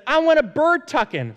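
high, loud imitation of an excited child; eager child's request. Hannah asking dad for a tuck-in, excited imitation. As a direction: pitch it way up, a kid begging to play. Voicing his excited young daughter.

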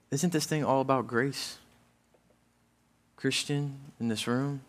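ordinary delivery

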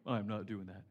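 low, soft grumpy Scrooge voice; husband mocked as a Scrooge refusing Christmas in July; full out Scrooge. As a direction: grumble a flat refusal down low. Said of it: "Full out Scrooge".

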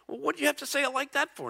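high, fast, put-out question; hammed-up touchy protest; mock spousal complaint. Husband objecting to how his wife said it, mock complaint. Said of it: "and I'll say".

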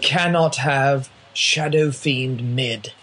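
slow, put-on British caster voice; measured mock-British authority; British announcer. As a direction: pronounce it like a British game commentator. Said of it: "the tenor of that voice".

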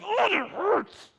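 high, drawn-out yelp of pain. Acted. Dad bumping his burnt hand, pained. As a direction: groan it slowly as the hurt hits.

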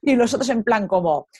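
ordinary talk, nothing marked

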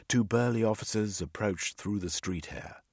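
ordinary delivery

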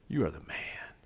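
soft breathy whisper to him; whispered praise. Shown as a demonstration. Wife whispering to her husband on Valentine's Day, hushed. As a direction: lean in and whisper it admiringly.

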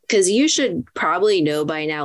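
ordinary delivery

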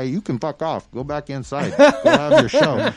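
laughing through the cop's brush-off; laughing cop quote. Retold or imitated. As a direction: quote the cop while cracking up. Retold cop telling owner off.

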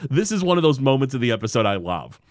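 ordinary talk, nothing marked

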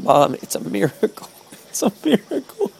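tearful, overwhelmed cry. Retold or imitated. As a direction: voice breaking with tears. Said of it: "while I cried and yelled".

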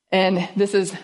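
usual speaking voice

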